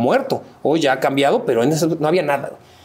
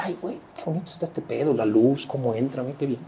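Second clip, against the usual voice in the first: asombro susurrado; 3 semitones lower, 8 dB quieter than usual. Retold or imitated. Awed at pink Paris sunset.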